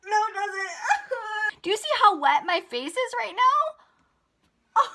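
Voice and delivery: high, loud, half-crying laugh through the words; laughing frustration. Real, not acted. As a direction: laugh in disbelief at the mess. Spray soaks her face.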